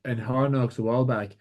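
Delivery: usual speaking voice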